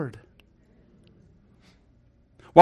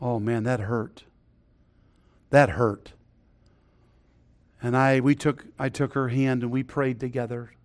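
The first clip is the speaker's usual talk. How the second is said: hushed sorrow; 7 semitones lower, 10 dB quieter than usual. Real, not acted. Preacher recalls his wife's pain.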